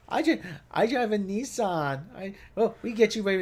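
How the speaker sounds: high-pitched Nissan ad parody; mock ad actress.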